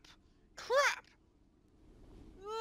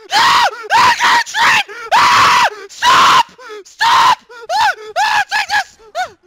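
high, loud frantic battle screams; frantic screaming; 9 semitones higher, 19 dB louder, slower than usual. Real, not acted. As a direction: scream while fighting for life. Fighting off attackers, screaming.

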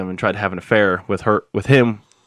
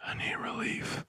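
mock whispered plea; mocking whispered line; 18 dB quieter, slower than usual. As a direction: breathe it out in a whisper. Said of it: "I'm like".

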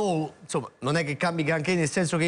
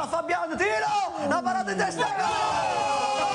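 rising fast, ending in a shouted goal; building goal call; 10 semitones higher than usual. Real, not acted.

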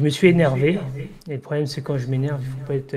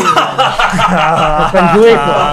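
laughing; 9 dB louder, much slower than usual. Real, not acted. Laughs off keeping them waiting.

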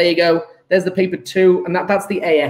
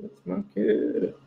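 softer muttered take; 9 dB quieter than usual. Real, not acted. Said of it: "repeated take".

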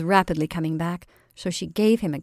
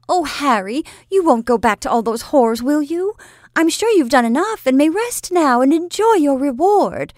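put-on higher, louder plea of an anxious young woman; young woman pleading; 8 semitones higher, 7 dB louder than usual. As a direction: beg Harry not to go back. Kate's pleading voice.

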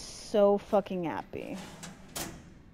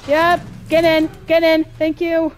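loud, higher-pitched panic; frantic yelling; 4 semitones higher, 8 dB louder than usual. Real, not acted. Player being chased and bullied by this guy, frantic yelling. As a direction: cry out while fleeing an attacker.

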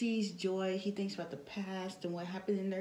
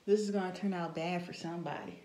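ordinary talk, nothing marked

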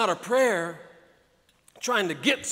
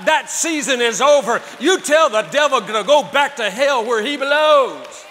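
louder, higher, shouted preaching; full-force preaching; 3 semitones higher, 9 dB louder than usual. Acted. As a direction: hurl it at the devil from the pulpit.

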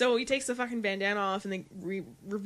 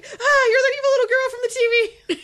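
high, loud, excited recognition; surprised fan; 13 dB louder, faster than usual. Retold or imitated. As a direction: squeal it out like meeting a TV star. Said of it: "In Scary Movie three".

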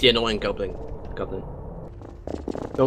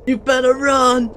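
high, loud, slow yell; shouted threat; 13 semitones higher, 5 dB louder, slower than usual. Acted. Threat while shooting teammate.

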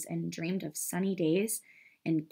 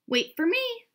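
high-pitched, louder duckling call; 5 dB louder than usual. A narrator's reading. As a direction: call after the others as a little duckling. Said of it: "called the ugly duckling".

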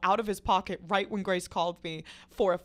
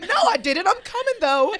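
high, loud hollered protest; retold scream; 11 semitones higher, 10 dB louder than usual. Screaming to friend that she is on her way, high loud yell. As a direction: yell back in hammed-up panic. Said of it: "And I'm screaming".

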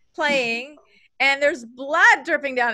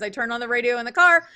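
usual speaking voice

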